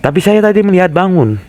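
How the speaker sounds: desperate to convince the officer; insistent. Acted. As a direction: push hard to be believed.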